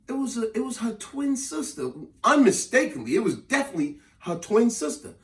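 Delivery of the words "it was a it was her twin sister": ordinary talk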